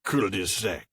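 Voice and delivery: slow hammed-up French pronunciation; mock French accent. Host echoes the silly borrowed word, mock French accent. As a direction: say it in exaggerated French.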